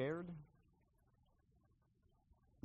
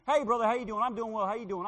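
cheery heavenly greeting; 7 semitones higher, 5 dB louder, faster than usual. Pretend. Imagined chat with saint in heaven.

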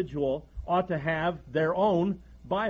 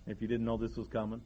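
hushed aside; 8 semitones lower, 9 dB quieter than usual. Real, not acted. Pastor confides to his congregation, hushed and softer. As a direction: drop the voice, softer, as if sharing a knowing aside.

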